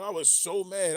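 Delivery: loud, fired-up complaint; loud rant. Real, not acted. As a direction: belt it out, venting. Ranting about Tyson.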